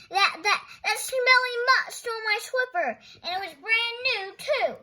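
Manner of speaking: high, loud sputtering outrage; outraged. Acted. Thorndyke raging at Nelson's dog, loud and high. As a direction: splutter with fury at the mutt.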